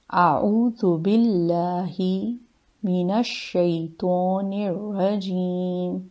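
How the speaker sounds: slow, drawn-out chanted recitation; slow Quranic recitation. Acted. Teacher reciting the full ta'awwudh for students. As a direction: recite it slowly and with care.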